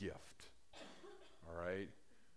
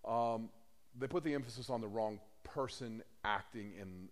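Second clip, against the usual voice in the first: ordinary delivery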